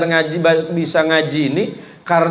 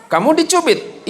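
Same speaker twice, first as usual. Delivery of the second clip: higher mock mother's question; lightly voiced mother checking on her child; mother asking; 10 semitones higher than usual. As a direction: play the worried mom asking gently.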